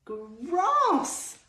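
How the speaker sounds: "grass" drawn out high and loud; "grass" said high, loud and long to teach the g sound; sounded-out word. Shown as a demonstration. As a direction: stretch "grass" slowly, high and loud, for the children. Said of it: "beginning with the sound g".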